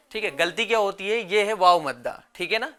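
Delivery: ordinary talk, nothing marked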